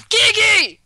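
mock angry yell.

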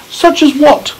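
put-on a child's curious question as James; James asking. A narrator's reading. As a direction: lift the voice up for the boy. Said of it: "said James".

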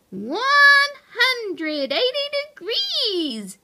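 high, drawn-out call; triumphant yell. A narrator's reading. Pipsqueak yells the new angle to the hamsters, drawn-out yell. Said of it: "she yelled".

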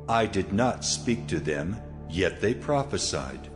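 ordinary delivery, nothing marked

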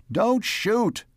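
high-pitched plea to stop; urgent cry. A narrator's reading. Man facing the guns to protect the alien, crying out. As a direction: cry out high, without much volume. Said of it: "he cried".

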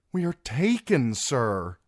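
urgent appeal; calling out. A narrator's reading. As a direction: call out the surrender to him. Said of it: "Arianne might have called out".

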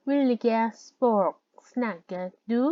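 Vil ge språk snakker du said word by word, very slowly; very slow demo. Shown as a demonstration. Teacher slowly models a Norwegian question for students, very slow and clear. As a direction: spell out each Norwegian word for learners. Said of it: "språk แปลว่าภาษา".

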